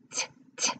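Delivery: breathy voiceless t sounds; just the bare consonant; whispered letter sounds. Shown as a demonstration. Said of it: "phonics sound demo".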